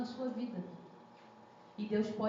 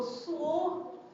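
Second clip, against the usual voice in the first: usual speaking voice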